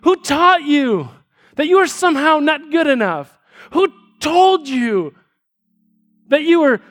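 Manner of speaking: dad begging his daughter to see the lie; pleading father. Retold or imitated. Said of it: "he says".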